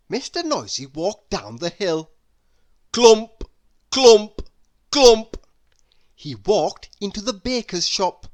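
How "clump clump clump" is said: loud stomping sound words; heavy clumps. Acted. Mister Noisy walking down the hill, loud heavy clumps. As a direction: stamp each clump out loud.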